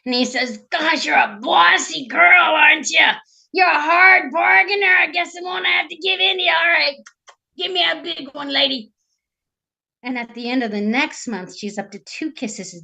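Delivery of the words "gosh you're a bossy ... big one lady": brash frog, giving in. Acted. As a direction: crow it like a cocky frog haggler. Voices the wheeling frog.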